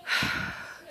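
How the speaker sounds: a breathy grumpy huff like a sleepy dog woken up; imitated dog huff. Vlogger imitating her dog grumbling when woken, breathy huff. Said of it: "she would go".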